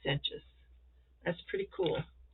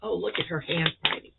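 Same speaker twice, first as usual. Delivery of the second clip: usual speaking voice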